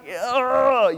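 high, loud exasperated ugh; frustrated groan. Retold or imitated. Dad fed up with his kid, loud high groan. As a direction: groan out your fed-up feeling. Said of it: "and I'm like".

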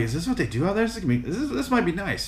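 high, lightly voiced curiosity; retelling a wide-eyed first impression; retold wondering. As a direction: lift the pitch as if tentatively hopeful.

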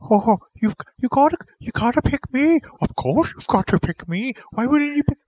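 whiny high plea, pick me; high pleading voice. Pretend. Voicing an actor begging to be picked.

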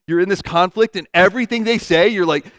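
normal talking voice

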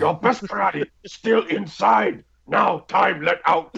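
high, halting Miyagi voice; lightly voiced wise old teacher; Mr. Miyagi impression. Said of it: "He's like".

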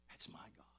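whispered, hushed awe; awed whisper to the congregation; reverent whisper. Real, not acted. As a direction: breathe it in a whisper. Awed aside after praise.

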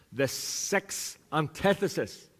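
slow, careful run at a tongue-twister; really slow. Shown as a demonstration. Preacher practising a phrase he can't say, really slow. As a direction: separate "six" and "antithesis", taking each slowly. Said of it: "If I say it really slow, I can say it".

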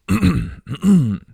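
real throat clear. Real, not acted. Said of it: "makes my throat a little coffee".

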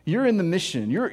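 ordinary delivery

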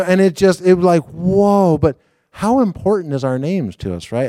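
ordinary talk, nothing marked

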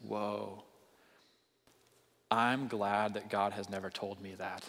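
low, slow, hushed reaction; sobered relief. Retold or imitated. Preacher imitating readers of the story, sobered relief. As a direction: say it slowly and softly, as if taken aback.

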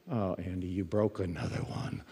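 low, hushed self-reproach; quiet, sinking "oh no"; dismayed aside. Retold or imitated. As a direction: say it under your breath to yourself. Preacher's dismayed aside to himself, retold.

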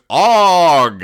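loud grunt. Acted. King kicks and falls.

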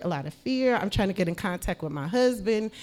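usual speaking voice

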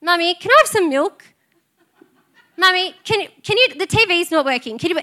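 imitating her children. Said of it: "two of my children walk up".

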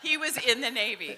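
loud, firm finish; loud teasing. Real, not acted. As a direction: say it loud to win the joke. Finishing the tease loudly.

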